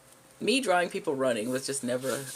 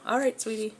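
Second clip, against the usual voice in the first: cafe worker, sweet; 8 semitones higher, 3 dB louder than usual. Acted. Voicing cafe worker's reply.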